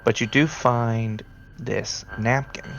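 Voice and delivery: normal talking voice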